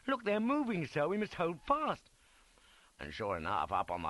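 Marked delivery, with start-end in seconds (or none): 0.1-2.1 high, urgent warning cry; frantic. A narrator's reading. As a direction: warn your commander in alarm. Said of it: "He pointed uphill frantically".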